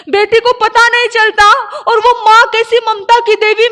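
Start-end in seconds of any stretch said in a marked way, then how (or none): none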